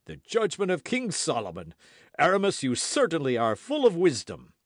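high, loud acclaim; jovial guardsmen's exclamation; crying out approval. A narrator's reading. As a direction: proclaim it to the whole company. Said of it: "cried the other two guardsmen".

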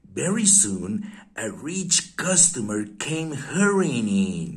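usual speaking voice

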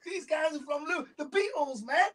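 very high-pitched mock shout of amazement; excited American fans. American fan telling friends about the Liverpool visitors, excited mimicry. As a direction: imitate a fan spreading the thrilling news. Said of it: "Especially when we talk".

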